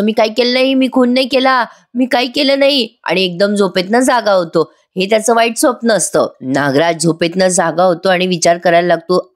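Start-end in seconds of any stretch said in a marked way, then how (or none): 0.0-2.9 mock yell. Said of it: "Nagraj मोठ्याने ओरडत असतो".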